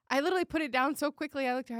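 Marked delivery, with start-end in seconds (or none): none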